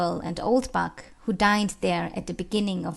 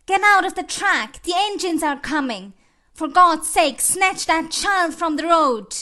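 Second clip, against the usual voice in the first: high, loud, dramatic street cry; urgent warning shout; 7 semitones higher, 5 dB louder than usual. Acted. Bystander warns of coming engines, urgent high shout. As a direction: shout to clear the road at once.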